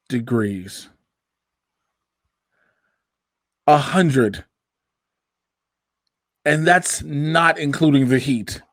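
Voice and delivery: word by word with long pauses; slow, disbelieving emphasis. Real, not acted. Host complaining to co-host about the outside heat, slow and deliberate. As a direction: spell out the complaint slowly, piece by piece.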